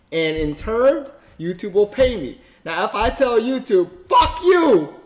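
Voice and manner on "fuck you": imitated defiant shout; mock shout. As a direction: fling it out defiantly. Quoting defiance to YouTube.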